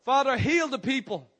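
fervent prayer shout. Acted. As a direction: shout the prayer high and loud. Call and response prayer.

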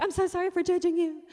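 high, loud movie-melodrama apology; mock Hollywood reconciliation wail; hammed-up tearful apology. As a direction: overact the repentant older brother. Hammed-up Hollywood reconciliation, older brother.

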